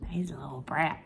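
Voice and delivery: soft fond whisper. Real, not acted. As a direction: whisper it teasingly. Whispering about the cat.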